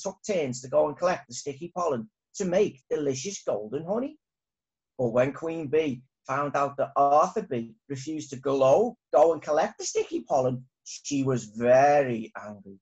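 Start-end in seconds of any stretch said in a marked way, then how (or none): none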